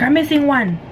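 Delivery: high, alarmed mom voice; worried. Retold or imitated. Mom counting kids in earthquake.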